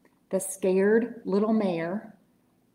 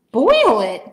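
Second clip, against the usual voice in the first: shocked, much louder gasp; 11 dB louder than usual. A narrator's reading. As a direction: gasp it in horror. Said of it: "gasped Horton".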